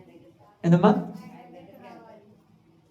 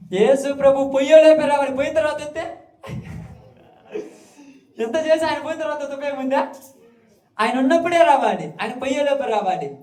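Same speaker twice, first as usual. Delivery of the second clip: raised, higher preaching voice, pressing the point; fervent preaching; 6 semitones higher than usual. Acted. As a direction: preach it out with urgency. Preacher rising to full force.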